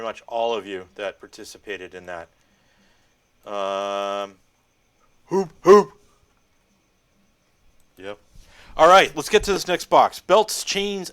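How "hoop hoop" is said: playful hooting. Pretend. Playful noise before next box.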